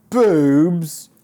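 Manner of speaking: high, loud slurred [name] voice; [name] impression. Quoting [name] on the movie, loud [name] voice. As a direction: drawl it like [name]. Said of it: "[name]'s like".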